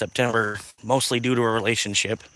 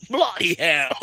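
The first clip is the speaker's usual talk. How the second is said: put-on British caveman swearing in an English accent; hammed-up English accent; mock English accent; 3 semitones higher, slower than usual. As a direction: imitate an English caveman cursing. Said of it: "the only actual English was bloody hell".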